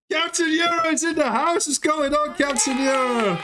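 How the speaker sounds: high, excited hype call; hype announcer. Real, not acted. Hyping viewer arrival.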